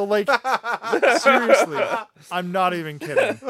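insisting through laughter; laughing insistence. Real, not acted. Host defending a silly point to co-hosts, laughing. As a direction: try to be serious while laughing.

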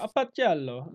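loud mock Italian boss; Italian accent. Italian boss calling his worker, mock Italian accent. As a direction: call out as a comic Italian boss. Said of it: "the boss comes in and goes".

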